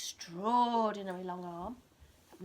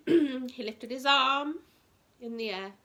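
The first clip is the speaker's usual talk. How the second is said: slow and louder, drawing it out; slow dramatic build; 3 semitones higher, 9 dB louder, slower than usual. A narrator's reading. Narrating to a child, slow suspenseful build. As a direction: stretch each word to build suspense.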